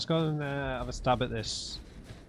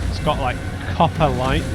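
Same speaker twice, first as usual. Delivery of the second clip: much louder excited commentary; loud tense; 3 semitones higher, 11 dB louder than usual. Real, not acted. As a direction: call it out over the fight. Mid boss fight, tense.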